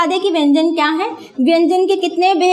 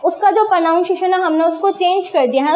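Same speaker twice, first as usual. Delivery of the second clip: ordinary delivery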